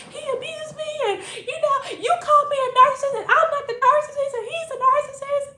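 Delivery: very high, fast, loud whine; mock-wailing girlfriend. Girlfriend defending herself to the visitor, mock tearful wail. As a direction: imitate a hysterical, tearful girlfriend. Imitates girlfriend pleading her side.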